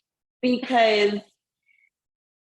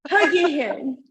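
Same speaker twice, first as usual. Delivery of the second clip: mock call out; 6 semitones higher than usual. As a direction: call for his owner, teasing. Mock calling for the owner.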